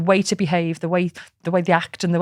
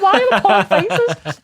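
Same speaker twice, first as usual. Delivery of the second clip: high, laughing self-mockery; laughing at herself; 12 semitones higher, 5 dB louder than usual. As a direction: laugh through the question at your own faces. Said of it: "I'm like".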